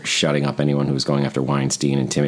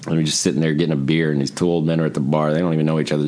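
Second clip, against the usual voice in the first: ordinary talk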